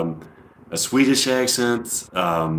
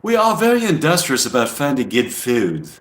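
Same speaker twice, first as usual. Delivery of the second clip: higher-pitched imitation English accent; 4 semitones higher than usual. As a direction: voice the quote as an English speaker. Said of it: "I'll say it with an English accent".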